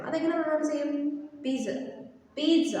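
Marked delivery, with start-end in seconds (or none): 1.4-1.7 പിസ്സ with a long ee and a ts sound; peet-suh. Shown as a demonstration. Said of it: "Z U H എന്ന് pronounce ചെയ്യണം". 2.4-2.7 പിസ്സ with a long ee and a ts sound; peet-suh. Shown as a demonstration. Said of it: "Z U H എന്ന് pronounce ചെയ്യണം".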